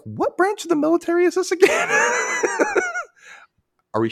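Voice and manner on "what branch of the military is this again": high squeaky shout of confusion; mock shout. Squeaking at the odd uniforms, shouting. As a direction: yell it in a cartoon squeak. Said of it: "I'm like".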